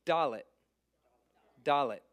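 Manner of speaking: "dalet" stressed on the first syllable; Hebrew letter name. Shown as a demonstration. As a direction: say "dalet" as "dah-let". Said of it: "it's a D sound".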